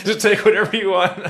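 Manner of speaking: high mock-frightened begging; mock pleading. Handing over his wallet to a screamer, mock pleading. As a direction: plead for mercy, hammed up.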